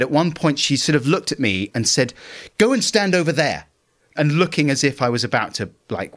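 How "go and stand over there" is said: higher-pitched sharp retold command; a testy order, pitched up; mock indignant order. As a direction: imitate an indignant wife ordering him away. Wife's order in retold row.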